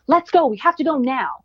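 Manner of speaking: high, loud snapping "let's go"; angry mom. Retold or imitated. Mother reenacting snapping at her daughter in the morning, loud and high. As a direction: retell losing patience at your kid. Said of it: "Then I start getting angry".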